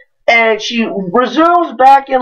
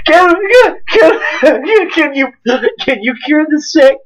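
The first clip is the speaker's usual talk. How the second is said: mock awe; 6 semitones higher, slower than usual. Grateful people in line treating him like God, awestruck. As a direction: gush like meeting a savior. Awed bystanders praising him.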